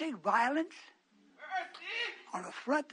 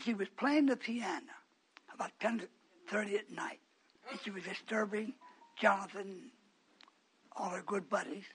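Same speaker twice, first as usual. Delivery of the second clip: ordinary talk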